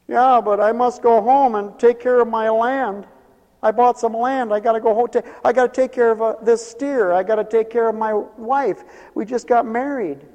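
hammed-up high-pitched protest; excuse-maker. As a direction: play someone protesting with a list of excuses. Acts out guests making excuses.

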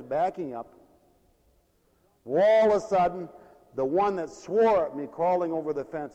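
usual speaking voice

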